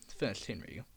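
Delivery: ordinary delivery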